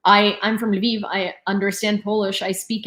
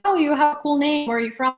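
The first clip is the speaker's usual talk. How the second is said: lightly voiced, higher-pitched small talk; imitated American suitor; 7 semitones higher than usual. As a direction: mimic a chatty match, pitched up. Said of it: "every single one of them is like".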